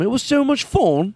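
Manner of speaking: high, gushing mock-British; put-on British accent. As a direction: gush it with British vowels. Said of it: "doing a British accent".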